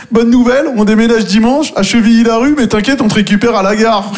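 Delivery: high, breezy fake-nice voice; mock cheery friend. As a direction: sell the favour like great news. Friends calling about moving.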